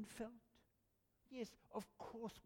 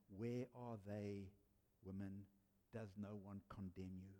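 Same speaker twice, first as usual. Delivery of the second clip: gentle Jesus; 11 semitones lower, 5 dB quieter than usual. Acted. Jesus speaks gently to woman.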